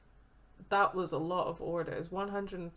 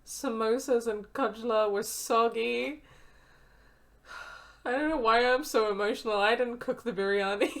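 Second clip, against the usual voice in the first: higher, louder voice near tears; strained and emotional, on the verge; upset character voice; 4 semitones higher, 5 dB louder than usual. Acted. Cook reading harsh reviews of his food, voice strained and upset.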